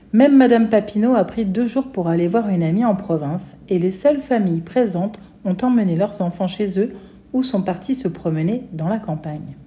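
ordinary delivery

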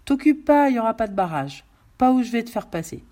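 réplique haute et forte de malfaiteur; homme armé sûr de lui. Acted. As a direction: balaie l'objection avec autorité. (voix forte de meneur qui coupe court)

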